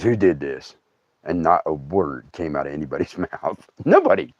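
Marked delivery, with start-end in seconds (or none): 0.0-0.8 low, quiet questioning; stern uncle. Retold or imitated. Uncle straightens up to question the kids, low and stern. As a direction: drop low and soft to question them. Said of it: "he straightens up. He goes".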